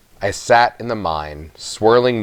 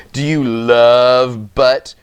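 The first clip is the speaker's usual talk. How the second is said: slow, sneering, drawn-out bully tease; mocking taunt; 2 semitones higher, 4 dB louder, much slower than usual. A narrator's reading. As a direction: stretch it out to mock her.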